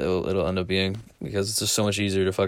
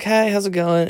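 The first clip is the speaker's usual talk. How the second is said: put-on girl's voice; 10 semitones higher than usual. Girl voiced in story.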